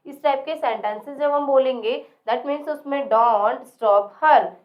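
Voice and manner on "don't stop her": don't stop her very slow and louder; slow emphatic modelling. Shown as a demonstration. Teacher slowly modelling don't stop her for the class. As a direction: punch it out slowly for learners. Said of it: "teacher models English slowly, loud".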